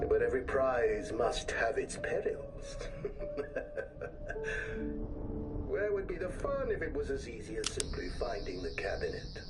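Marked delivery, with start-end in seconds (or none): 2.8-5.6 breathy high sinister laugh; evil laugh. Acted. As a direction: laugh like a gloating villain. Villain gloats about the perils.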